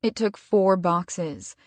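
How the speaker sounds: usual speaking voice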